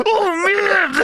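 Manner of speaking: very high mock yell; hammed-up high wrestler shriek; Macho Man. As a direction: imitate a wrestler losing it. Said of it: "And Macho Man yells".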